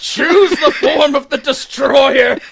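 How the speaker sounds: booming slow villain decree; dark lord. Pretend. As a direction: boom it like a god of destruction. Booming villain quote at lobsters.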